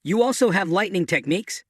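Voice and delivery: ordinary delivery, nothing marked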